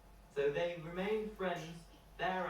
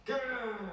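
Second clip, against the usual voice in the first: a playful storybook growl, higher and a bit louder; bear growl; 9 semitones higher, 5 dB louder than usual. Acted. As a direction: growl like a friendly bear for kids.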